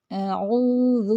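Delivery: اعوذ slowly with a soft ذ; soft ذال. Shown as a demonstration. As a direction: model it gently, soft ذ. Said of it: "ذال کو نرمی سے پڑھنا ہے". (اعوذ میں ذ کو نرمی سے)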